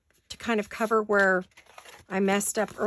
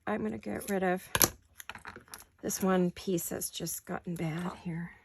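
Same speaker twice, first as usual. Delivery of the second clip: whispered aside; 3 semitones lower, 6 dB quieter than usual. Real, not acted. Muttering aside while cutting.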